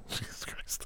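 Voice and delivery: breathy laughing whisper; whispered through laughter. Real, not acted. Laughing whisper after joke.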